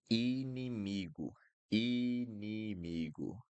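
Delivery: inimigo stretched slowly, i-ni-mi-gu; slow clear inimigo. Shown as a demonstration. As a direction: draw out each syllable of inimigo. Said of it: "And then we have".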